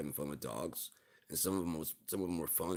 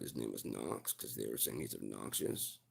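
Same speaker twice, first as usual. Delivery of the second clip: ordinary talk, nothing marked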